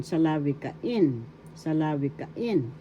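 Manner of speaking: teacher's model of salawikain, accent on IN; stress on fifth syllable. Shown as a demonstration. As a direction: lean on the final in of salawikain. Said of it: "syllable has the accent".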